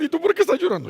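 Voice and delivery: high, loud incredulous question; acted bystander, pitch way up; puzzled questioning. People gathering ask each other about the crying, puzzled.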